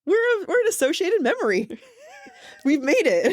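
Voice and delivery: high, fast giddy laughing words. Real, not acted. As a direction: laugh through it, delighted. Thrilled at being someone's memory.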